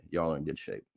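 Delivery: a hushed, low repeat of the clerk's line; clerk's hint said hushed and low; quiet repeat. Retold or imitated. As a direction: drop your voice to pass on the clerk's hint. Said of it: "That's all he would say".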